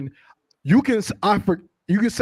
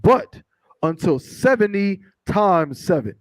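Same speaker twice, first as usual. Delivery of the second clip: slow, measured reading aloud; scripture reading; 4 dB louder, slower than usual. Acted. Reader giving the congregation verses from Matthew, slow and declamatory. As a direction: read it out deliberately, word by word.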